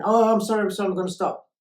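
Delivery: loud, high mock pleading; hammed-up repeated sorry; manipulative apology. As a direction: gush a hurried apology to placate. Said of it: "then they're gonna yell again".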